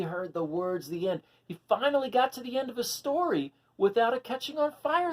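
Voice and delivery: lively, high storybook voice; animated read-aloud for little listeners; reading to children. A narrator's reading. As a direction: read it out like a picture book for kids. Reading picture book to children.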